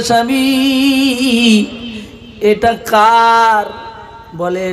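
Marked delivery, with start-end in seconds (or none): 0.0-3.7 high, slow, drawn-out wife's question; husband's gift makes the wife wonder, drawn out; voiced wife. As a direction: stretch it out like a surprised wife. Wife asks husband in story.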